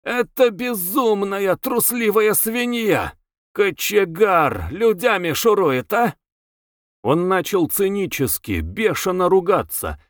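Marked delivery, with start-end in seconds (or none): 0.0-6.1 high-pitched, drawn-out angry wail; slow, high wailing rant; howling outburst. A narrator's reading. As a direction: howl the insults, stretching the words. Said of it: "Он хлопнул шапкой об пол и завыл".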